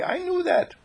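very high-pitched, pleased chirp; squeaky acted eagerness; eager piping voice. A narrator's reading. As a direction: pipe it up like a delighted child. John eagerly repeats himself.

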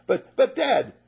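high, loud stammering protest; whiny son. Acted. Older son cutting in on Dad, high and whiny. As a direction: sputter like a jealous brother.

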